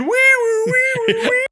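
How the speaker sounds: very high wee-woo ambulance siren; siren imitation. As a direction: sing out a siren noise. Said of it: "Tommy Boy".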